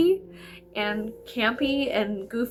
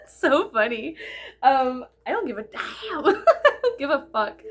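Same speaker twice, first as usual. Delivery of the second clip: giggly defiant words; laughing while shrugging off criticism; 5 semitones higher than usual. Real, not acted. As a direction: laugh through it. Laughing at own rant.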